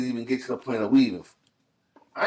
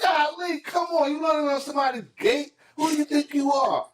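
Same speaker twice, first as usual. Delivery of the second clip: mock squeaky whine; 11 semitones higher than usual. Mocking the gate guy.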